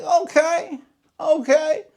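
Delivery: very high-pitched, retold okay; surprised acknowledgment. Lawn worker once husband toned down, high-pitched mild surprise. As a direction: pitch it way up, lightly taken aback. Reacting to neighbor's tone dropping.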